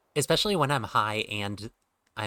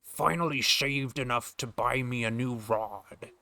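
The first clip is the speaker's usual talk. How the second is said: slow Scottish sailor, sh for s; slow Connery-style old fisherman; Sean Connery voice; 3 semitones higher, slower than usual. Acted. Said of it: "the Sean Connery aspect of me doing this voice".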